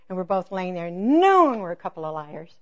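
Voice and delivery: ordinary talk, nothing marked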